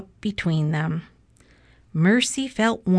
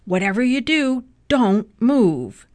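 raised, urgent storybook warning; shouted warning; 4 semitones higher, 5 dB louder than usual. A narrator's reading. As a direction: build to a loud last command. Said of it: "shouted Mister Watson".

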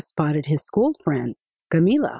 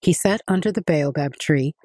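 ordinary delivery, nothing marked

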